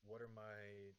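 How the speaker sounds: ordinary delivery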